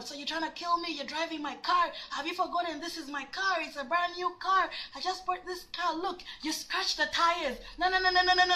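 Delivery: high, whiny nagging imitation; yapping boyfriend. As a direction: rattle on in a high, fussy mock voice. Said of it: "he's going on and on".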